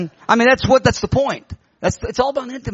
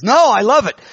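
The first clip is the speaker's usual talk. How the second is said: loud, high retold enthusiasm; really excited; 7 semitones higher, 7 dB louder than usual. Delivered husband tells his wife he loves church, excitedly. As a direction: burst out with acted delight. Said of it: "he is really excited".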